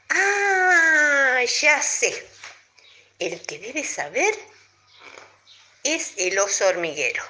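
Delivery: toad voice. A narrator's reading. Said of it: "el sapo dijo".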